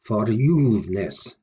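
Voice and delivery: verJUVEness, slowly, strong long juve; stress on juve. Shown as a demonstration. Teacher showing what happens naturally when saying it, juve stronger. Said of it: "you'll pronounce the juve bit more strongly".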